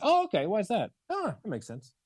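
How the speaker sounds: high, breezy, unbothered; casual nonchalant interest; light don't-care curiosity; offhand curious. Shown as a demonstration. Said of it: "you don't really give a fuck".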